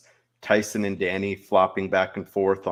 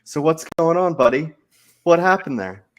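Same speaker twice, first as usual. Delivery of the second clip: mock interrogation; 8 semitones higher, 7 dB louder than usual. Players looming over rivals, mock-questioning.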